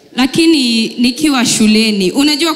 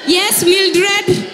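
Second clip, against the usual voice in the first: mock schoolteacher; prim teacher; 7 semitones higher than usual. Teacher calls on pupil.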